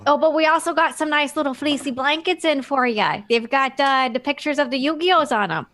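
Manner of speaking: lightly voiced Southern clerk; folksy clerk letting a customer down gently; Southern accent. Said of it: "like, Southern".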